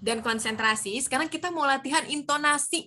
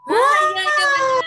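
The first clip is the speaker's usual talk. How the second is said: shrieking high, loud delight; excited praise; 12 dB louder than usual. Real, not acted. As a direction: cheer the student's answer. Praising a student's answer. (seruan gembira yang keras)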